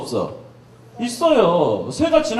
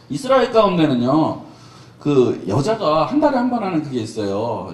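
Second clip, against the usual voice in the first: ordinary talk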